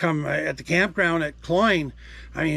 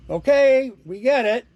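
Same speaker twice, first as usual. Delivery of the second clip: high, louder mock exasperation at the beeping; exasperated; 8 semitones higher, 6 dB louder than usual. Real, not acted. Talking to the beeping gadget, exasperated and loud. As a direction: tell the beeping thing to cut it out.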